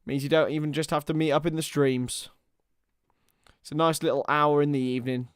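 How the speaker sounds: ordinary delivery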